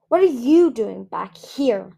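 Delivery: loud, slow, demanding challenge. Acted. Bromeliad confronting Kinkajou at the river, loud and demanding. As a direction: make her answer for being back.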